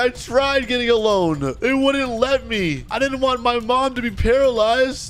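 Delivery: high, slow, drawn-out plea; desperate pleading. Acted. Robber pleading for his mom, high and desperate. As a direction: plead your case in a high, strained-up pitch.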